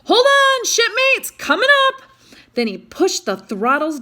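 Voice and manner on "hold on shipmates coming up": high, loud warning yell. A narrator's reading. Dean warning the crew as the boat hits the waves, a loud high yell. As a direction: bellow it over the waves. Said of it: "Dean yelled out".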